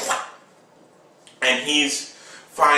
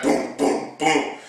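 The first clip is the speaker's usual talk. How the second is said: quick puffed booms; imitated blows. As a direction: mouth the thuds of a fight.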